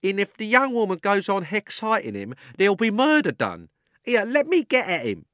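high, loud rough cabman calling out; cockney cabby shouting. A narrator's reading. Cabby shouts over the crowd at the policeman, high and loud. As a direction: bellow at the policeman like a London cabby. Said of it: "said the cabby".

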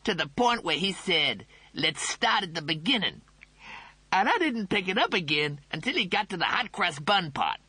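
cocky dude voice; cool cat voice. Acted. The cat speaks.

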